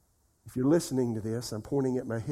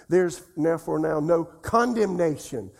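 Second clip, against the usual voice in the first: "condemnation" hit hard and louder; stress on condemnation; 2 semitones higher, 4 dB louder than usual. Shown as a demonstration. Preacher emphasizing each word in turn, "condemnation" punched. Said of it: "Emphasize each word".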